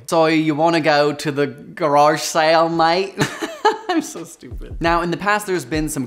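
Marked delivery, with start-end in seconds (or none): 0.1-3.1 loud, hammed-up Aussie bloke; broad mock Australian asking about a garage sale; Australian accent. Host joking in a broad Australian voice, loud and hammy. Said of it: "Australian accent". 3.2-3.8 high burst of real laughter; laughing. Real, not acted. Host laughing at her own Aussie bit.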